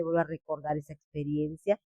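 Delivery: ordinary talk, nothing marked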